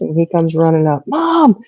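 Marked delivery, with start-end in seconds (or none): 1.1-1.6 put-on high, eager voice of a young son; excited kid. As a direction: bubble over like a boy with big news. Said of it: "he was so excited".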